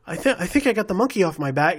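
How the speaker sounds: high, quick helium-tinged impersonation; spunky high-voiced musician impression. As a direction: raise the pitch as if on a little helium. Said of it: "like he's just inhaled a little bit of helium".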